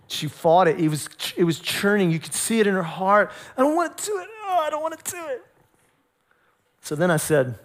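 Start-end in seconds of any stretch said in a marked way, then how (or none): 3.6-5.4 high, small mock whine; whining child. Daughter fighting dad over bedtime, high mock whine. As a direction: whimper a kid's reluctant protest. Child's inner protest, acted.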